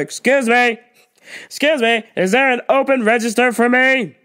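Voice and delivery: loud, demanding customer call; imitating the pushy shopper demanding service, loud; entitled customer. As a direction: snap for attention like you're owed it. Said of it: "She's like".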